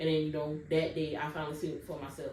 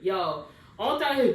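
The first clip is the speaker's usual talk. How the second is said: ordinary delivery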